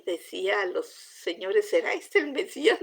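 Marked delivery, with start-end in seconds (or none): 1.7-2.8 high, wondering question; eager question. Retold or imitated. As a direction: ask it with excited wonder. Samaritan woman asking villagers. (pregunta aguda y asombrada)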